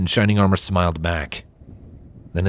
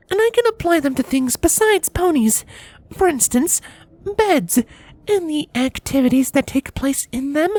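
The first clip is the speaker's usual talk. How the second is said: high mare voice, cool and pointed; icy warning from a princess; frosty Cadance. A narrator's reading. Cadance coldly warning her husband, high voice.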